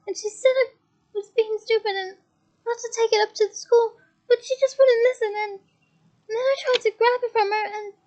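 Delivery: distraught. A narrator's reading. Leanne explaining the necklace to Hermione, distraught. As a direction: spill it out in distress.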